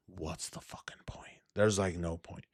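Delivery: voiceless, deflated whisper; defeated whisper. Real, not acted. Streamer whispering to chat about pointless stream pay, defeated. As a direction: let it sink to a bare whisper.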